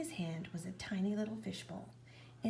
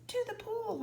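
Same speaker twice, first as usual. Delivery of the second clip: put-on a boy's high shout of urgency; yelled; 14 semitones higher than usual. A narrator's reading. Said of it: "I yelled".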